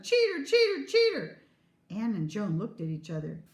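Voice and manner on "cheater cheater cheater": put-on high, loud childish chant; loud repeated accusation; accusing shout. A narrator's reading. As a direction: shout it like a name-calling kid. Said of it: "kicked at the door and shouted".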